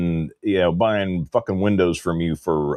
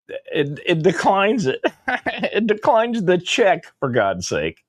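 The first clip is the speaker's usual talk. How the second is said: high-pitched, laughing incredulity; laughing disbelief; 11 semitones higher than usual. Real, not acted. Man telling how Home Depot declined his check, laughing in disbelief. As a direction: let a real laugh break through, pitched well up.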